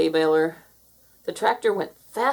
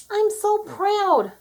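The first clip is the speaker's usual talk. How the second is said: proud; 13 semitones higher, 4 dB louder, much slower than usual. A narrator's reading.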